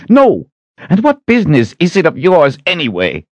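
high, loud flustered retort; shrieked-up defiant comeback; indignant outburst. A narrator's reading. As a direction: burst out in indignant protest. Kaelin bursts out indignantly at Shota.

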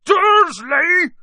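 high, full-force roar of the name; booming shout. A narrator's reading. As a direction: boom it out in fury. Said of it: "he boomed".

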